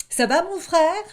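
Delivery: high, loud call of a small wolf; high little wolf shouting a question. Acted. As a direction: call over the wall to your brother. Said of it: "cria le louveteau".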